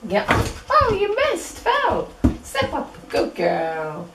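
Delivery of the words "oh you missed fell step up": squeaky dog voice. Acted. High puppy talk.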